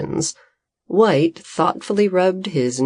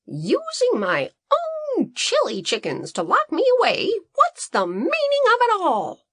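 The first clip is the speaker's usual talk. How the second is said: much higher, indignant farmer voice; complaining Green. A narrator's reading. Groggy farmer scolding his assistant, high indignant complaint. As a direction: demand an answer, put out. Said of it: "he complained".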